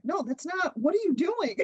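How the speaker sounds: high-pitched, imitated dismay; incredulous retold scolding; mock disapproval. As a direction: imitate a partner stopping you, pitched well up. Partner's correction acted out.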